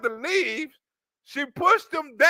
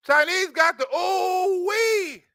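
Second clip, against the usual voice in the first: drawn-out emphasis; 4 semitones higher, slower than usual. Acted. Comedian hyping punchline.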